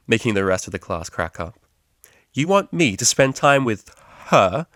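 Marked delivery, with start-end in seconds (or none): 2.3-4.7 loud, slow, outraged disbelief; indignant exclamation. Acted. Octavia protests the teacher's plan, loud and appalled. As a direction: she can't believe the suggestion. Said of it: "exclaimed Octavia".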